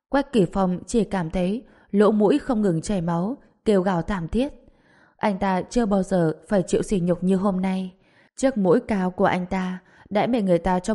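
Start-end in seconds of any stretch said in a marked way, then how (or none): none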